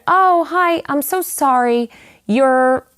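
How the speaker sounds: high, anxious apologetic hello; high and nervous, greeting her birth mother. Retold or imitated. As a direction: apologize while hoping to be recognized.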